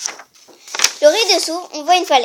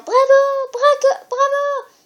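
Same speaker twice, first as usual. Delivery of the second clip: high cheering bravo; crowd cheering; 11 semitones higher, 5 dB louder, much slower than usual. Acted. The audience cheering, high and excited. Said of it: "Le public en chœur".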